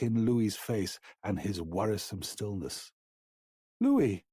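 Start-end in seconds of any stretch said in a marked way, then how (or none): 3.8-4.2 frightened question. A narrator's reading. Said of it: "he asked, gently shaking his friend".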